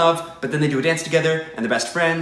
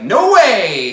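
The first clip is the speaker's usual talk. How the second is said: defiant yell; 5 semitones higher, 7 dB louder than usual. Retold or imitated. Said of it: "then she's like".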